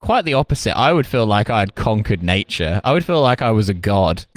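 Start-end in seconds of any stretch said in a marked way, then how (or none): none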